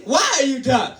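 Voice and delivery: high mock African scolding; African accent. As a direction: restart in a hammed-up African accent. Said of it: "I'll start over".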